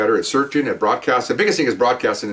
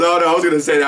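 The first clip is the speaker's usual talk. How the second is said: laughing; 7 semitones higher, 5 dB louder than usual. Retold or imitated. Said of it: "So he starts laughing".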